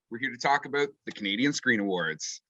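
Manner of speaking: normal talking voice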